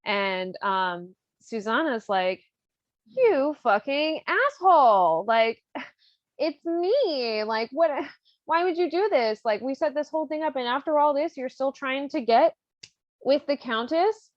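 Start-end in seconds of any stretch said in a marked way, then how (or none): 3.1-14.3 high, hammed-up indignation with laughs breaking in; mock outrage. Susanna confronts Figaro for coming on to Countess, mock outraged. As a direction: scold him in disbelief, pitched up.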